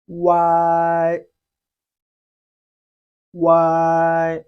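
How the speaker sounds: loud, held wei for students to copy; Chinese wei. Shown as a demonstration. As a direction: model the syllable clearly. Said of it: "must be read before the I sound".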